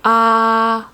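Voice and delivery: "ah" held long and level; flat tone. Shown as a demonstration. Teacher modelling tone one, "ah" held very flat. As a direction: keep "ah" on one steady, unchanging pitch. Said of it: "also called the flat tone".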